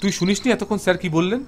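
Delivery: high exasperated retort; irritated. Acted. Investigator to his friend, exasperated and high. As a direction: snap back, annoyed at the question. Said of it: "বিরক্ত দৃষ্টিতে তাকালো".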